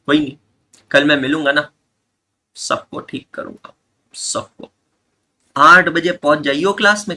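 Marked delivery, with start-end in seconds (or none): 2.6-4.7 whispered playful threat; mock threat whispered. Teacher jokingly warns the class he'll fix everyone, whispering. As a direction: breathe it like a joking menace.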